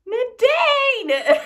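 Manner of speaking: loud, high squeal of the name; overwhelmed cry. Real, not acted. Thrilled by friend's gift, high loud squeal.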